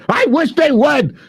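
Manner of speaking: high, slow, put-on dare; daring taunt. Streamer taunting attackers, high and slow. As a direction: drag it out as a challenge.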